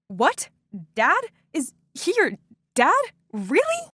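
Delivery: rising, stunned, halting questions; stammering in disbelief. Retold or imitated. Girl stammering at the neighbour's dad in shock. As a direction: catch on each word as it sinks in. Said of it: "I stammered".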